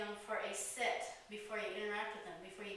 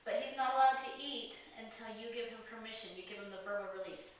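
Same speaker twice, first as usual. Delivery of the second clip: ordinary delivery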